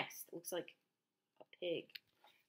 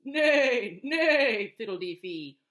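horse neigh; 10 dB louder, slower than usual. Acted. Horse voice in story.

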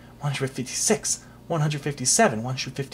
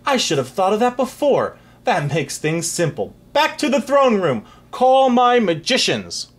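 high, loud royal orders; a king calling loudly for his magicians; eager commands; 9 semitones higher, 5 dB louder than usual. Acted. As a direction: summon them with glee.

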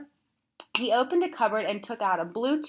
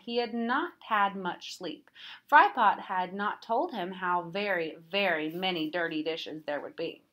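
plain reading voice

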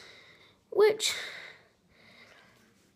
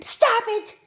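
high squeaky puppet shout; squeaky ventriloquist dummy; 9 semitones higher, 7 dB louder than usual. Acted. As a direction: shriek like an annoyed puppet. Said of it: "I'm a ventriloquist".

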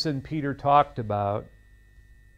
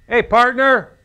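loud, high hearty Texas drawl; Texan greeting; 8 semitones higher, 10 dB louder than usual. Retold or imitated. Preacher imitating a Texan greeting, loud and high. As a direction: call out like a Texas rancher. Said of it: "down in Texas ... they might say".

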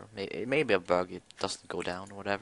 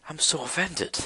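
mock offended; 7 semitones higher than usual.

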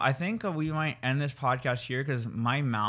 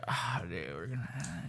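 low, soft, fading imitation; tired co-host; 2 semitones lower, 8 dB quieter, slower than usual. Mimicking co-host's favorite sign-off, exhausted low mumble. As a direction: trail off as if nodding off. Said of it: "You, like, get tired and you're just like".

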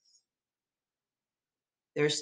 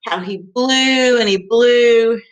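louder, stretched-out words; drawn-out storytelling; 3 semitones higher, 6 dB louder than usual. Acted. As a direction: draw out the wolf's huge effort. Retelling the wolf's blowing.

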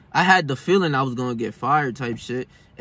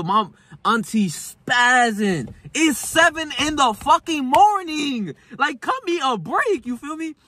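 higher, slow, fed-up complaint; a worn-out nephew groaning about a yelling auntie, higher and drawn out; exasperated whine; 9 semitones higher, slower than usual. Retold or imitated. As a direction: whine it like you've had enough of the noise.

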